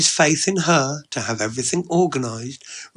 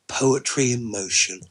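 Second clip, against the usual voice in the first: usual speaking voice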